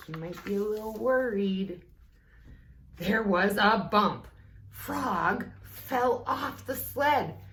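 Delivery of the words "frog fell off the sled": ordinary delivery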